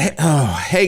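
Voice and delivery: ordinary delivery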